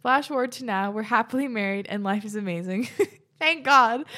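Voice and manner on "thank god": laughing relief. Real, not acted. Relieved laugh at happy ending.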